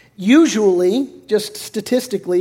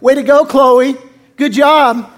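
sarcastic praise; 4 semitones higher, 6 dB louder, slower than usual. Retold or imitated. Mocking Chloe for telling.

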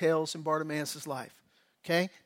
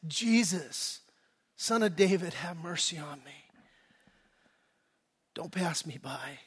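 soft, falling plea, dropping lower at the end; hushed inward prayer for mercy; quiet heart cry; 4 semitones lower, 6 dB quieter than usual. Acted. A cry of the heart to Jesus, quiet plea. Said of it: "Maybe not vocally. Maybe it's just the, the emotion".

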